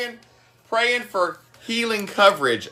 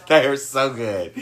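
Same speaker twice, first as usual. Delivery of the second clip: chuckling while raving; laughing through words; 4 semitones lower than usual. Real, not acted. Raving about turkey burgers.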